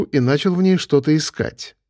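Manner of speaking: plain reading voice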